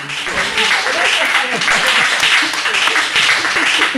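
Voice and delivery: a burst of loud breathy laughter. Real, not acted. Laughing loudly at her friend's teasing comment. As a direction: laugh out hard at the remark.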